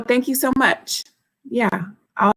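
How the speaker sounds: ordinary delivery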